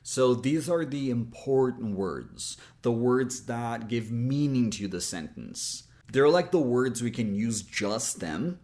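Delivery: normal talking voice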